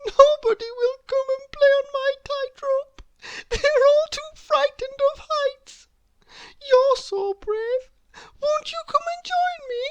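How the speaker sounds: put-on high, pleading girlish voice; little Miss Somersault. A narrator's reading. Little Miss Somersault begging Mister Brave to join her, high and pleading. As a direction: coax him up sweetly, high. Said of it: "she sobbed".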